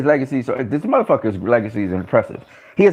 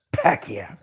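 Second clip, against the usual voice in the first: awed whisper; stunned hushed Pacquiao; 5 semitones lower, 7 dB quieter than usual. Real, not acted. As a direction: breathe the name in awe. Stunned by Pacquiao's bold move.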